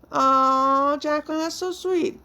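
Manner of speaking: high-pitched, drawn-out "aww" of gratitude; sweetly moved, voice lifting high; touched, tender. Real, not acted. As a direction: coo it high, touched by kindness. Touched by a viewer's kind comment.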